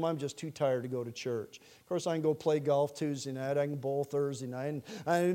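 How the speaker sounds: low, soft mumbled justification; mock weary excuse. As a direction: act too tired, voice dropped. Acted tired excuse-maker lets slip golf.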